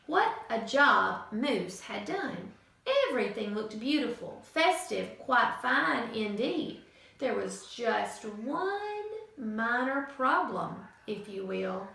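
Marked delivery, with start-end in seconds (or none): none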